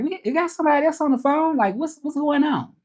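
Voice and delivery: high-pitched, mystified questioning; bewildered caller. Retold or imitated. Caller hearing an unexpected accent on the line, bewildered. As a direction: mimic a thoroughly puzzled caller. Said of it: "they felt like it was somebody else".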